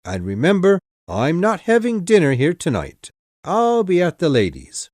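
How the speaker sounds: high, loud mocking sneer; wicked taunt. A narrator's reading. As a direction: jeer at him, pleased with yourself. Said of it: "Drummle smiled wickedly".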